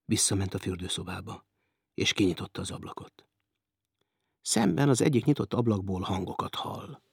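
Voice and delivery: normal talking voice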